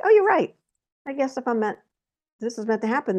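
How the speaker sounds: high-pitched "oh" of sudden acceptance, easing down; retold meek concession. Woman concedes to Spirit after crying, high-pitched retold concession. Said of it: "I stopped yelling and I'm like".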